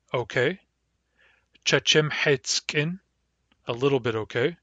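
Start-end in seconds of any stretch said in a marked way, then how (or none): 1.6-3.0 Chechem heitskin said slowly, rising at the end; slow demo. Shown as a demonstration. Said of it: "Let's build that word".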